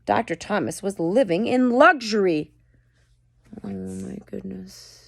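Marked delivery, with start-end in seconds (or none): none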